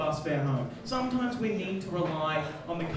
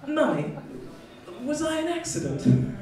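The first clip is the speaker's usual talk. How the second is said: put-on higher-pitched little kid; child's voice; 8 semitones higher than usual. Son Jabin asks his mummy, in a high child voice. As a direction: ask it as a small child.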